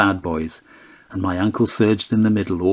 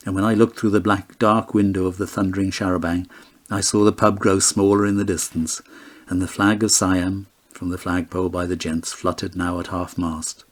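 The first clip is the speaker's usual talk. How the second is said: ordinary delivery, nothing marked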